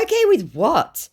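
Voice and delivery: high, rising demand; mock challenge. Acts her challenging reply.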